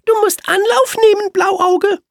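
sehr hohe, laute, etwas herablassende Rehstimme; hilfsbereit, aber von oben herab; leicht hochnäsig. Acted. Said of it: "ein kleines bisschen hochnäsig". (ein bisschen hochnäsig, sehr hoch und laut)

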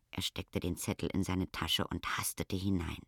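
ordinary delivery, nothing marked

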